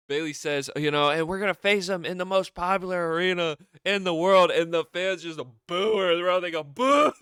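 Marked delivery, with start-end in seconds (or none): 1.1-4.5 high, loud hammed-up promo boast; playful high wrestler-promo shout; mock hype promo. Retelling Bayley's boast. 6.6-7.1 high, loud laughing boo; mock arena boo, loud and high; crowd boo. Imitating crowd booing.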